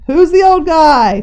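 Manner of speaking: loud, slow shout. A narrator's reading. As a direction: shout the question across the lobby. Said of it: "shouted O'Reilly".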